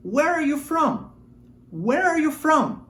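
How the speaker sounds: "Where are you from?" slow and high, stress on "are"; slow model for repeating. Shown as a demonstration. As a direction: say it slowly so they can copy. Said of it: "Repita comigo". (modelo lento e agudo para repetir)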